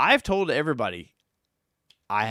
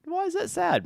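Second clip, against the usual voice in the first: surprised. Real, not acted. Teased as sad, protests.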